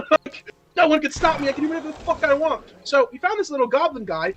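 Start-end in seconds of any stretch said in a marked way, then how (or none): 0.1-2.6 giddy newbie boast. Retold or imitated.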